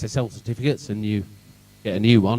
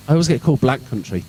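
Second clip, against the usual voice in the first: ordinary talk, nothing marked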